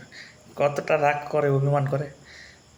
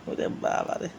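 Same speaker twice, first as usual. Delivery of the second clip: whispered exclamation; 6 dB quieter than usual. Real, not acted. Marvelling at cat's sulk.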